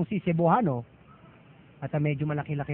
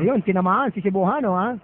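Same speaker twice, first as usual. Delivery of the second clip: excited; 5 semitones higher, 6 dB louder than usual. Real, not acted. Commentator reacts to hit.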